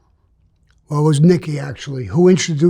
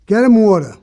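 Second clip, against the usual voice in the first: louder, higher barked order; a boss calling for water, raised voice; retold order; 6 semitones higher, 6 dB louder than usual. Mob captain orders water.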